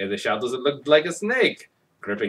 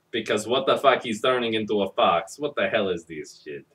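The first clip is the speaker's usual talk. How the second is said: usual speaking voice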